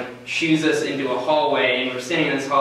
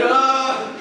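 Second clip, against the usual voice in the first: startled oh; 11 semitones higher, 3 dB louder than usual. Retold or imitated. Startled in the boiler room.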